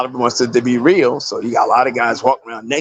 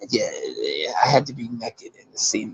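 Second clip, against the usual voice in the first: much softer, low sheepish admission; 3 semitones lower, 9 dB quieter than usual. Retold or imitated. Admitting to his wife, quietly and sheepishly. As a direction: mumble it out, embarrassed.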